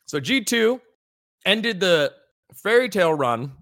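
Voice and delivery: talking through a mouthful; words round a bite of candy; chewing while talking. Real, not acted. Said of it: "Sorry, I'm chewing".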